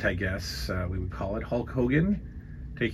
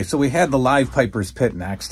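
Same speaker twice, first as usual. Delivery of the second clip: ordinary delivery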